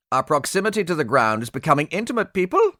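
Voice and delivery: fast, high, edgy with fear; a frightened pilot keeping it wry; high-pitched fear. Acted. Pilot warning the crew, fear in voice. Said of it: "the high-pitched edge of fear in his voice".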